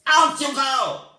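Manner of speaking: loud witch command; shouting. Acted. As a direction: shout them out of the room. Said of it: "she shouted".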